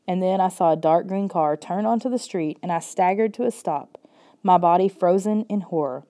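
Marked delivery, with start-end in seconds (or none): none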